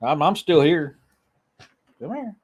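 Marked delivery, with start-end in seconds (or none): 2.0-2.3 high, soft coaxing to a pet; talking to the cat. Real, not acted. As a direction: coax the cat over gently. Calling the cat again.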